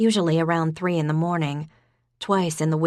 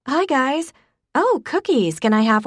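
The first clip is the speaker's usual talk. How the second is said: bright greeting; 9 semitones higher, 5 dB louder than usual. A narrator's reading. Faith greets, cookies.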